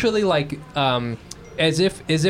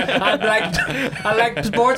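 lightly mock-grumpy old-fashioned guy, higher, with a laugh in it; bigoted guy impression; 6 semitones higher, slower than usual. Host mocks the guest, voicing a cranky sports snob. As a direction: laugh while playing the guy who hates new sports. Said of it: "He's like".

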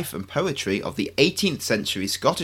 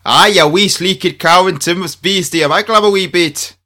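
higher, louder mock Scottish brogue; hammed-up Scottish accent; 6 semitones higher, 6 dB louder than usual. Ed answers Julia in a hammed-up Scottish accent, higher and louder. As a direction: ham up a thick Scottish accent. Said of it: "Ed, you're not Scot".

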